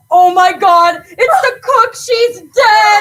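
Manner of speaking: high, loud wail of shock; horrified cry. Acted. Guest finds the cook dead in freezer, horrified cry. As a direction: shriek the discovery in horror. Discovering the cook's body.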